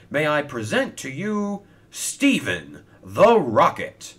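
loud, high ceremonial introduction, stretched out; grand announcer. Acted. Sir Topham Hatt presents Stephen to the crowd, grandly announcing. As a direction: proclaim it to the whole crowd.

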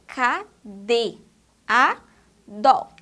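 "K D A dó" as four beats, the last two loud; syllables separated. Shown as a demonstration. Teacher showing where DE sits, syllables separated. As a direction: spell out "K D A dó" syllable by syllable, punching A and dó. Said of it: "tá vendo?".

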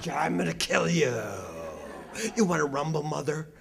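menacing dog voiced as a street tough; mock tough dog. Country dog threatening the walking lecturer, mock menace. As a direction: growl a challenge like a tough guy. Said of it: "they go".

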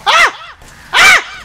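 two sharp high screams of fright; real frightened screams. Real, not acted. Player startled by the monster, screaming in fright. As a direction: scream out in sudden terror. Jump scare in horror game.

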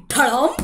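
loud thud word; crash sound. A narrator's reading. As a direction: bang it out like a fall.

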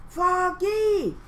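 high loud call of alarm; alarmed cry. Acted. Teacher cries out to fallen Froggy, loud and high. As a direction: cry out in fright. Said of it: "cried Miss Martin".

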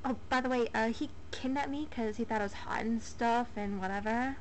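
ordinary delivery

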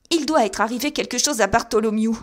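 high, loud terrified outcry; bursting in crying out in panic; frightened cry. A narrator's reading. Thaddeus runs out to Holmes, crying out in terror. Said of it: "cria-t-il".

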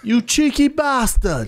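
slow, high Cockney jeer; hammed-up Cockney; mock Cockney. Joins the Cockney bit.

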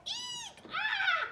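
very high squeal, then loud scream; acted high-pitched screams of fright; frightened shrieks. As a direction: shriek like people spotting a shark. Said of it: "all the people that see the shark are going".

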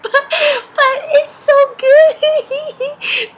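high, drawn-out giggly squeal; imitating an excited mom bursting with a secret; imitated giddy mom. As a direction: squeal it slowly, mock-gushing. Mom gushing over a secret.

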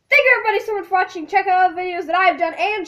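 rapid, loud sign-off; fast outro. Real, not acted. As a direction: rattle off the outro quickly. Rushed loud YouTube outro.

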